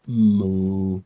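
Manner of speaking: low mock moo; cow moo. Streamer showing off his cow sound. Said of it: "I could do a good cow".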